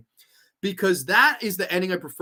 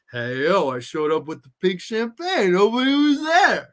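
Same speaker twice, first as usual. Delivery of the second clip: Stallone; 3 dB louder than usual. Retold or imitated. Imagining the actor as Rusty Nail, rising impression. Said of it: "Stallone as Rusty Nail".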